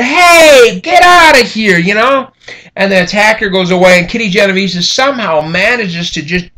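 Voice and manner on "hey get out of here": high, loud yell from a window; neighbor shouting. Retold or imitated. Neighbor yelling at the attacker from a window, high and loud. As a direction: chase him off with a shout. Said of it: "Windows fly open".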